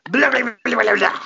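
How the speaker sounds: loud, high caveman blabbering; mock caveman gibberish. Hosts pretending to be cave people, loud gibberish. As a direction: babble nonsense like a rowdy cave dweller.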